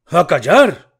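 father stamping his feet at his son; shouted while stamping. A narrator's reading. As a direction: stamp and roar for silence. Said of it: "gritó el padre, pataleando".

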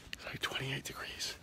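whispering. Real, not acted. Whispered remark about the cold.

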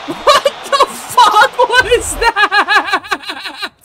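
very slow, laughing, stretched high shout; laughing disbelief. Real, not acted. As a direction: laugh it out, dragging every word. Laughing at a bad play.

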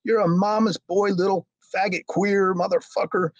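high-pitched outraged mock tirade; retold group outburst, incredulous and scornful; losing it. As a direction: tear into him in disbelief, voice way up. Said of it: "they just lose their shit".

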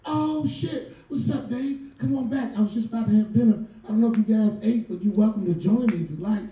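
high, quick excited host voice; Kevin Hart impression. Kevin greeting Dave at his door, high and excited. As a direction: bounce it out like a surprised host. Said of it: "Kevin came around the corner".

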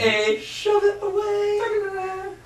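high mock voice. Repeating the quiz option mockingly.

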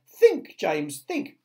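high, loud urgent plea; frantic imploring; begging. A narrator's reading. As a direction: implore him, punching the first word. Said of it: "begged Miss Spider".

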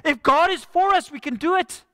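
high, loud bold cry; rally them with certainty; confident faith. Retold or imitated. Joshua and Caleb urging the people, high bold cry. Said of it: "Joshua and Caleb saying".

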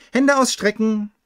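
playful shout to the blindfolded guest; barked command. Acted. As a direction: order her to hold out her hands. Party host commanding blindfolded girl.

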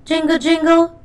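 sung-out high jingle; playful jingle. A narrator's reading. Narrator voicing the buried bell, high and playful. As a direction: ring it out like a little bell.

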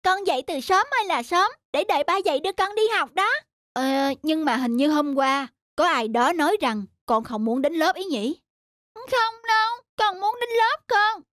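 put-on high, eager little boy voice; eager child. Son begging dad to take him to school, high and eager.

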